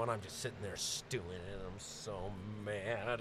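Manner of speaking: low, very quiet grumble; hushed, seething aside; sullen stewing. Acted. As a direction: mumble it low, dragging out the last words. Acting out sullen stewing alone.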